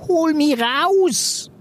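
high, drawn-out chameleon peep; squeaky peep. A narrator's reading. Chameleon Rosso begging to be let out, high squeaky peep. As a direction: peep it high, stretching the words. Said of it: "fiebte Rosso".

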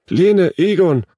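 high, loud call of names; anxious calling. A narrator's reading. Mother at the fence calls the children, anxious calling. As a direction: call out with dread. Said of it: "rief diese voller dunkler Vorahnung".

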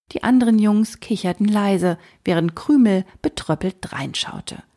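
usual speaking voice

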